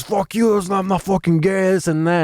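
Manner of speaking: mock drunk heckler shouting back; high, heated heckler yell; angry heckler. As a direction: snap back like an offended drunk. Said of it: "He goes".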